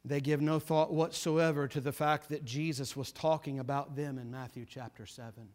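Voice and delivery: hushed grave sermon. Acted. Preacher warning the congregation of judgment, hushed and solemn. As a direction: drop the voice to warn the flock gravely.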